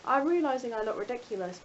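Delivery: normal talking voice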